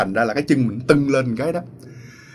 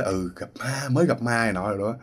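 shaken hush; 7 dB quieter than usual. Retold or imitated. Shaken guard wakes friend.

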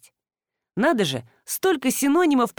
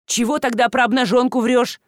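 loud, higher-pitched indignation; boiling over; 4 semitones higher, 6 dB louder than usual. A narrator's reading. Husband accusing his wife of lying, heated. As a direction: boil over at a false accusation. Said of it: "вскипел супруг".

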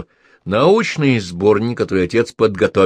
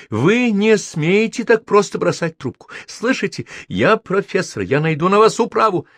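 indignant; 10 semitones higher than usual. A narrator's reading. Professor threatens policeman.

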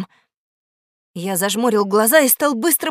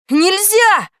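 high, loud cry of dismay; alarmed exclamation; 9 semitones higher, 6 dB louder than usual. Acted. Boy realises no pardon follows, alarmed. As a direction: exclaim it in shock, pitched up.

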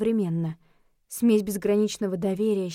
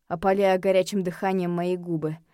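plain reading voice

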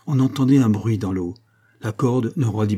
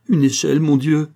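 higher, louder urgent cry; raised voice, pleading in distress; desperate plea; 6 semitones higher, 6 dB louder than usual. A narrator's reading. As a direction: cry out for help in alarm. Desperate mother begs for a ladder.

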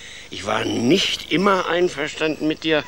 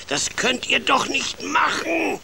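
hoch und laut empört; empörter Ausruf; 12 semitones higher, slower than usual. Acted. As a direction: entsetzt aufschreien. Empörter Großvater. (Großvater empört sich über den Enkel)

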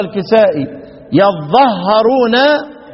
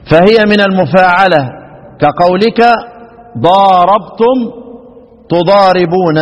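formal citation; slower than usual. Acted. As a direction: read out the classical text with authority. Quoting the imam loudly. (اقتباس رسمي بصوت عال)

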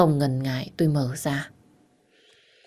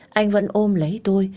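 ordinary delivery, nothing marked

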